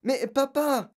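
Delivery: put-on high-pitched child's appeal; upset girl crying out; pleading protest. A narrator's reading. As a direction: object in dismay to your father. Girl protests to shouting father.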